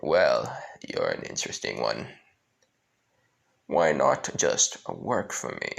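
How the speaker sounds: a deep, smiling devil offering a deal; smiling Lucifer. Acted. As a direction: drop deep and tempt him with a smile. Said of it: "Lucifer just smiling, saying".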